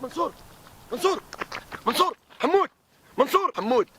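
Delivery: high calling names across distance. Acted. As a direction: call out for missing friends. Searching, calling missing friends' names.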